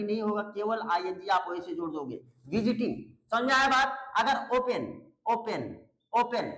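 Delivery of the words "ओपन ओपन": ओपन as O-pen, weight on the O; unstressed last syllable. Shown as a demonstration. Teacher shows second exception, ओपन stressed on the first syllable. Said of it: "last वाले syllable पे जोर नहीं पड़ता है".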